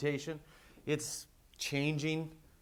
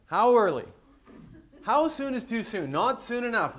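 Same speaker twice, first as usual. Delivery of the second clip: ad announcer; 8 semitones higher, 6 dB louder than usual. Retold or imitated. Reading old ad copy aloud.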